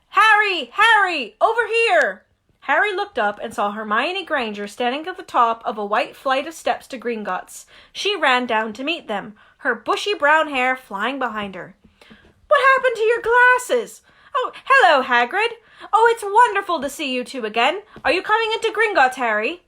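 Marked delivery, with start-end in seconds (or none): 0.1-2.3 high, loud call across the street. Acted. Hermione calling Harry from the bank steps, high and loud. 12.5-19.6 put-on high, loud eager girl's chatter; Hermione voice. Hermione greeting Harry and Hagrid, high and eager.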